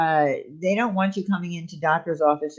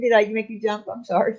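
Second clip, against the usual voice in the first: ordinary delivery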